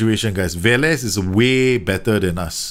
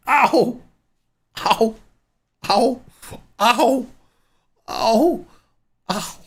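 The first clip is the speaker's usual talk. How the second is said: sharp high cries of pain; pained yelps; 11 semitones higher, much slower than usual. Real, not acted. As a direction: yelp in real pain. Leg cramp just hit.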